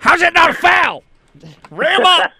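high, loud yell at the refs; Wade Graham. Retold or imitated. Imitating a friend at basketball games, yelling at the refs. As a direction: heckle the officials in an Indiana accent. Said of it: "yelling at the refs in an Indiana accent".

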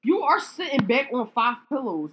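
exasperated shout. Real, not acted. Bickering over pillows.

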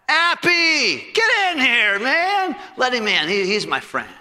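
high, loud call from the back room; excited shout. Retold or imitated. Paul calling his friend in from the back, excited shout. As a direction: shout gleefully to an old friend.

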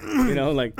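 ordinary delivery

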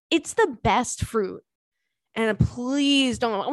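ordinary talk

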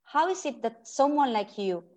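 ordinary talk

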